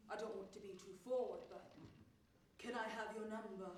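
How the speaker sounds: put-on charming London accent, low-key; rich London accent. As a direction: play the smooth Londoner, a touch hushed. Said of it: "says in his rich London accent".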